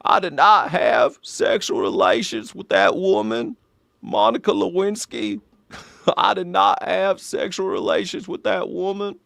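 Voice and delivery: slow old-guy Southern politician impression; deliberate, drawn-out denial in a put-on Arkansas voice; Bill Clinton. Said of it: "Bill Clinton impersonation is just an old guy".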